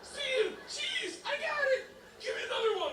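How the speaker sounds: put-on high giggly drunk voice; giddy, laughing through it.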